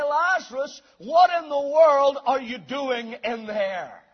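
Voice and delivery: loud, slow incredulous demand; amazed. Acted. Visitor to Lazarus in his old grave clothes, amazed. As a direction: demand an explanation in mock amazement. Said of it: "and you're amazed".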